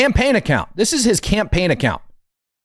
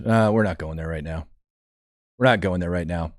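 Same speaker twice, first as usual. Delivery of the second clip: much lower and softer brush-off; quiet dodge; 11 semitones lower, 6 dB quieter than usual. Real, not acted. Host dodging a viewer's question, low soft brush-off. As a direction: mumble it low to wave the question off.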